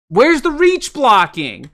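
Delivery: high, loud, drawn-out demand; pleading, frustrated yell; exasperated outburst. Real, not acted. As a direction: cry out in slow disbelief. Exasperated at the missed blocks.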